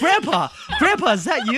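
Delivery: loud, delighted greeting to Grandpa; excited. Pretend. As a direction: call out to Grandpa in joy. Playing along with the impression.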